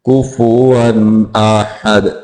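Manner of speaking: कुफुवन slowly with every vowel sounded, "ku-fu-wan"; slow tajweed कुफुवन; corrected recitation. Shown as a demonstration. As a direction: draw out कुफुवन, intoned. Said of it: "कुफुवन नहीं है".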